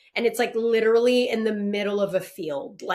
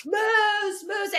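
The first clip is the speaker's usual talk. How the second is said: crew calling out; 11 semitones higher than usual. Retold or imitated. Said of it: "a bunch of people were like".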